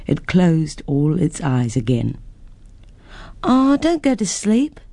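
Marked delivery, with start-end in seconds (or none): 3.4-4.8 put-on high, pleading little girl; begging girl. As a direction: plead like a child, much higher. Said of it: "begged Jennifer".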